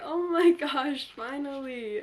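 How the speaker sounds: slow, loud, drawn-out relief; relieved. Real, not acted. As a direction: exhale the finally-over feeling. Alarm finally goes off.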